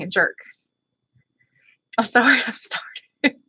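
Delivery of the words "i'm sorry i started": whispered giggling apology. Real, not acted. Embarrassed apology after a rant.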